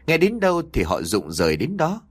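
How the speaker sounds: ordinary delivery, nothing marked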